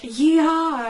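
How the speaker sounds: big drawn-out rodeo cheer; cowboy yeehaw. Acted. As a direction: holler it like a cowboy. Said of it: "like all the cowboys do usually".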